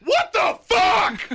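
slow, high shouted outrage; drawn-out furious yell; outraged shout. Real, not acted. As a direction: yell it in disbelief.

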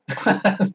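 a bright little laugh; short high laugh. Real, not acted. Laugh after the imitation.

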